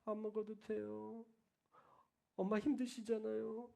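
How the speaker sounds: soft, very slow little voice; meek child. Retold or imitated. A hint of a shy child holding back a wish, soft. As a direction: sound small and pitiful.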